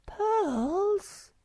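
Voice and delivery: high, thrilled gasp of a word; light up at the sound of pearls; excited burst. Acted. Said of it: "burst out the Snork Maiden excitedly".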